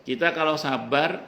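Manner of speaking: ordinary delivery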